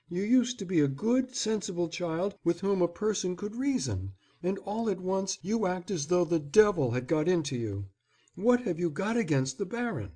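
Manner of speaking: lightly voiced mother, coaxing and puzzled; a mother reasoning with a stubborn child; mother's voice. A narrator's reading. Mother voiced pleading with son.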